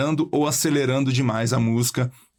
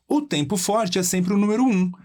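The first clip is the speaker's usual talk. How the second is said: ordinary delivery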